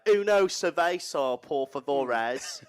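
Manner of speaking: slow Spanish in a blunt English accent; deliberately bad English-accented Spanish; hard English accent. Shown as a demonstration. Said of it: "worst language to say in an English".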